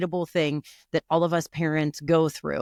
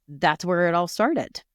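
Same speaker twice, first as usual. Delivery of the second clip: ordinary delivery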